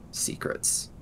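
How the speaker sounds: usual speaking voice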